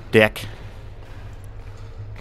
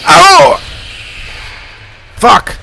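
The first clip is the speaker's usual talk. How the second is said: sudden high, loud startled yell; real fright scream; 9 dB louder than usual. Real, not acted. Player caught by the demon, real startled scream. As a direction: jump out of your skin. Monster catches him in game.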